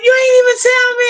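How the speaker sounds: high, mock-indignant friend's complaint, about six semitones up; mock friend complaining. Friend scolding her for not announcing the live, high mock complaint. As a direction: squeak out her friend's protest in a put-on voice. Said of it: "She was like".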